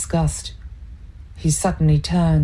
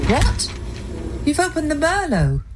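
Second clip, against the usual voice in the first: high, startled outburst; shocked; 11 semitones higher than usual. A narrator's reading. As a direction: catch her breath at the open bottle. Claire finds the opened wine.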